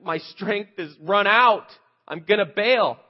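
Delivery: loud, high, slow wail of giving up; hammed-up defeat, drawn out; mock defeated wail. Preacher acting out quitting, loud slow high wail. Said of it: "this idea of".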